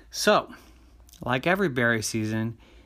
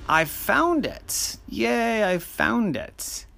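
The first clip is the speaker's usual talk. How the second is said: excited; 10 semitones higher, slower than usual. Acted. Boy's joyful discovery, slow high.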